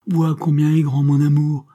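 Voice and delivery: loud and high, burning with love; passionate declaration. Acted. Carmela pleads with Hector, loud and passionate. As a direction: declare your love as if it could save you.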